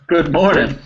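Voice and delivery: high, loud whoop into a hearty good morning; surprised, high-pitched exclamation; excited whoop. Real, not acted. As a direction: cheer on an early riser, loudly. Reacts to student's early hour.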